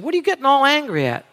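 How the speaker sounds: high, quick son's protest; mock teenage complaint; indignant son. Voices son judging his dad.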